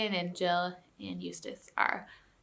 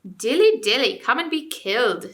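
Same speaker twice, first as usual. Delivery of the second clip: loud sing-song sneer; mock the ape with a nursery jeer; sneering taunt; 4 semitones higher, 7 dB louder than usual. Dwarf sneering at the ape, loud. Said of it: "sneered Griffle".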